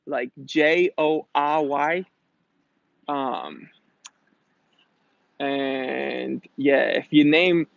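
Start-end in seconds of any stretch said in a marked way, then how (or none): 0.5-2.0 slow spelling with "R" said "ah"; New England accent. Retold or imitated. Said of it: "they couldn't pronounce the R's".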